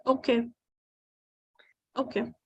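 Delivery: quiet, let-down acceptance; a small, deflated okay; disappointed. Real, not acted. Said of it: "Don't sound so disappointed".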